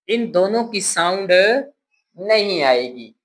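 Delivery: ordinary talk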